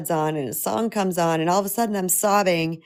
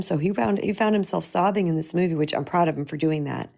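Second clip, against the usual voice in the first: usual speaking voice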